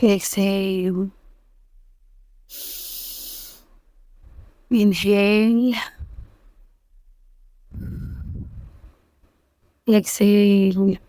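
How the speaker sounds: very soft, slow meditation voice with long pauses; hushed Islamic meditation, breath by breath; guided meditation. Acted. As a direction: guide them gently into calm, hushed and unhurried, drawing out the last words.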